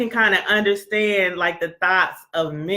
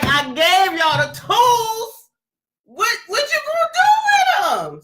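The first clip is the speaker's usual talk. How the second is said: mock scolding; 13 semitones higher than usual. Colleague challenging people she gave tools to, mock scolding. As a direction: stretch it out and climb into a high challenge.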